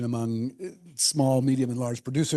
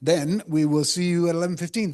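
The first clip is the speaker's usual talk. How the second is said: ordinary talk, nothing marked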